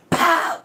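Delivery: high, loud "Pow!" for the hit; punch sound effect. Retold or imitated. Teller acts out the hit on the girl with a loud pow. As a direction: bark out the punch noise.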